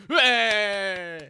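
loud, high lone hooray; late cheer. Acted. Cheering once the crowd has quietened down, loud. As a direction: cheer out on your own.

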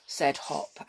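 ordinary delivery

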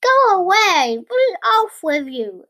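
high, loud shooing; cross shout. Acted. Tailor chasing flies from his jam, cross shout.

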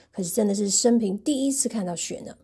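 plain reading voice

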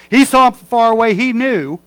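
loud, high, ringing preacher cry; preaching shout. Real, not acted. Preacher shouting to his congregation about Jesus, loud and high. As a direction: drive it home at full voice.

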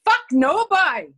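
put-on loud, high Newfoundland accent; Newfoundlander impression. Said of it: "he was probably more like".

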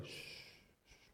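a hushed unvoiced shh shh shh; shushing. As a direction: hush them with a soft shh. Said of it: "One will just go".

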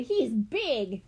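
put-on impressed little-boy exclamation; excited cry. A narrator's reading. Benny marvelling at the big peacock, excited cry. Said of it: "Benny cried".

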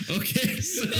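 squealing laughing fit; high giggles breaking in; high laughter. Real, not acted. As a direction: laugh through the words. Cracking up.

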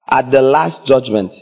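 ordinary talk, nothing marked

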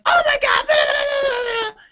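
very high-pitched hysterical mom impression; screechy mock freak-out, babbling away; mock mom yelling. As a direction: rattle off a hammed-up high-pitched tirade. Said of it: "starts to yell at me".

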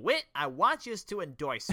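put-on drawn-out, loud Bart accent; hammed-up accent for Bart's plea. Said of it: "That's a great accent right there".

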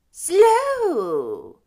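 high, loud drawn-out cry; indignant ghostly echo. A narrator's reading. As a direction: fling the word back at him. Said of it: "the ghost repeated".

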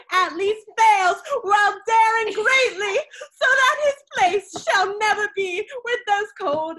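dramatic shout. Pretend. Dramatic climax of recited speech.

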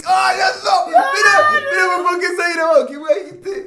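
very high, loud, overwhelmed outburst; amazed shouting. Real, not acted. Friend amazed by the improvisation, shouting excitedly. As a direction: shriek in disbelief at what you heard.

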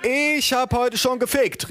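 high, slow, drawn-out holler; Bettina calling out. Acted. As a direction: sing out a proud announcement across the woods. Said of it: "rief Bettina".

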